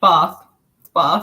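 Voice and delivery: bath with an 'ah' vowel; correcting demo of broad 'bahth' for bath; long-A bath. Shown as a demonstration. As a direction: say bath as bahth. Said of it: "No, a bath or a bath".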